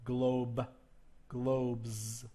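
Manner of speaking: "globes" ending in a buzzed z; "Globe" then "globes" with a clear z; exaggerated voiced ending. Shown as a demonstration. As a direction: lean on the voiced end of "globes". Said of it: "I'm emphasizing these and exaggerating the sound".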